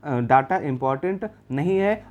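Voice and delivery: ordinary talk, nothing marked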